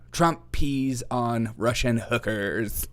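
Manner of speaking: slow, deadpan mock of a rumour. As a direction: drag it out like an absurd rumour. Mocking a claim she dismisses.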